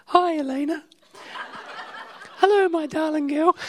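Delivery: high put-on mum voice, laughing through it; pretending to be Mummy. Dad doing a high laughing impression of his wife for their daughter. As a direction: play the wife for the little daughter, giggling. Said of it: "I've got to talk like this".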